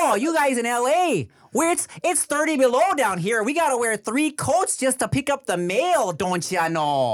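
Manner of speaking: put-on sing-song Minnesota accent teasing Californians; a friend mocking Minnesotans in a Minnesota accent. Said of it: "people in Minnesota are like".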